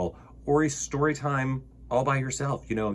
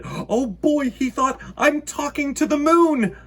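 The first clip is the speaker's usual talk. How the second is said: high, loud, thrilled squeal; very excited; 13 semitones higher, 6 dB louder than usual. Acted. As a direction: burst with delight. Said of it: "Bear got very excited".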